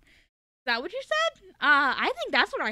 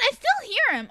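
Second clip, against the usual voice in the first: ordinary talk, nothing marked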